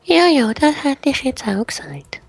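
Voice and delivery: very high, mock-agreeing voice; mock the excuse in a high, loud squeak that draws out; mocking disbelief. Real, not acted. Teammate doubting the excuse, high mocking voice.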